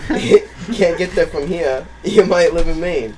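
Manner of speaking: loud, stretched Maine accent, laughing. Shown as a demonstration. Finishing the Maine joke for friends, loud hammed-up Maine accent. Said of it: "if I can read that in a Maine accent".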